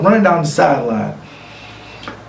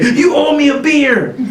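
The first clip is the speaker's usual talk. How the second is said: fan's voice; 11 semitones higher than usual. Retold or imitated. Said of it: "I heard him say".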